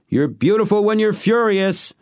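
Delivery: high, loud playful shout. A narrator's reading. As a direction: call it out across the yard. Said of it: "he shouted".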